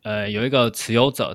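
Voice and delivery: ordinary talk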